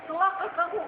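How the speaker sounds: angry rebuke. Acted. Ship captain rebuking the sailor who led him astray, angry cry. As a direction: scold him as if shocked and furious.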